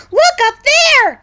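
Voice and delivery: high, loud call to look; excited cry. Acted. Birds calling out at flying pigs, high and loud. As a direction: point and shout upward.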